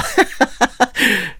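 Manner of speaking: a high, mocking burst of laughter at the player's bad play; scornful laugh. (posměšný smích)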